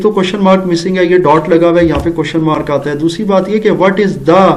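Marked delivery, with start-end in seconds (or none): none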